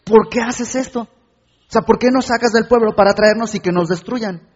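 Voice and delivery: high, loud whining protest at God; indignant shout of why at God; angry complaint. Retold or imitated. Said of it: "Y empieza a decirle".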